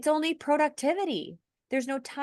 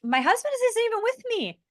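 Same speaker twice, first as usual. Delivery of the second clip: retold plea, voice jumping much higher; pleading in shock, pitched way up; distraught friend; 11 semitones higher than usual. Friend in hospital hallway pleading for her husband, distressed high plea. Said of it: "And she's like".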